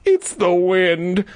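high, slow put-on kid; child hero voice. Hero telling Terry about the whistle, high slow voice. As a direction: draw it out high, explaining away the noise.

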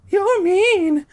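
very high, loud squeaky tease; high girly tease. Acted. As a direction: squeal it playfully. [name]'s high voice.

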